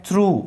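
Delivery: through as one syllable with a long oo; through pronounced throo. Shown as a demonstration. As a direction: model "through" clearly, rhyming with true. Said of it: "इसका pronunciation होता है".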